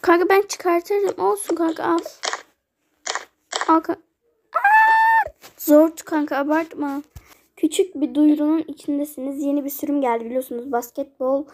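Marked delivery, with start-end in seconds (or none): 4.5-5.3 sudden much higher, louder shriek; scream. Acted. Friend shrieking as slime sticks to her eye. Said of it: "sabahtan beri çığlık atıyorsun".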